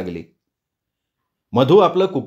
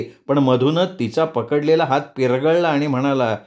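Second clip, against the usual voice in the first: ordinary delivery, nothing marked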